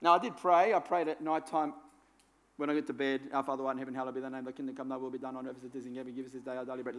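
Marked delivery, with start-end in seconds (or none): 3.3-7.0 fast, soft, mumbled through like a chore; Lord's Prayer gabbled in one breath at bedtime; rushed rote prayer. Shown as a demonstration. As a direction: rattle it off without meaning it. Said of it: "That, that fast".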